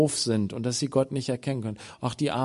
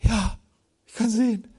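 joyful cry; 8 semitones higher than usual. Acted. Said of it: "Er so".

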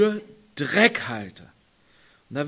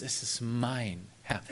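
"Es ist mein Herr" said low and soft, slowly, leaning on "mein" with a rise; quiet proud claim; 5 semitones lower, 6 dB quieter than usual. Retold or imitated. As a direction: savour "mein" like a treasured possession. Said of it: "voller Stolz".

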